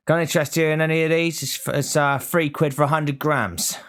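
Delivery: high, hammed-up Cockney market-trader patter; Cockney fudge seller pitching to a passer-by; Cockney marketeer. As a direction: do a teenage stallholder's Cockney sales pitch. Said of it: "talking like a forty-year-old Cockney marketeer".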